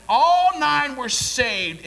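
loud, high and slow; triumphant proclamation. Real, not acted. Preacher proclaiming the miners were saved. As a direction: ring it out in triumph.

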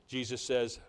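ordinary talk

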